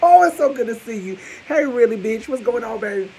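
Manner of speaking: high loud excited welcome; excited greeting. Acted. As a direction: gush over the guest. Over-the-top excited greeting.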